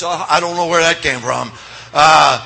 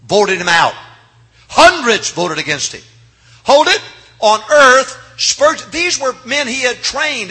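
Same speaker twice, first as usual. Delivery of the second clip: loud, higher, building outrage; preaching at full force; 7 semitones higher, 7 dB louder than usual. Real, not acted. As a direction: build to a ringing shout.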